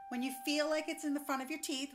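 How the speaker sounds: ordinary talk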